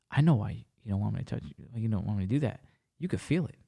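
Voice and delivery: low, soft retold voice; hushed knowing hint. Woman hinting at her touch, retold low and knowing.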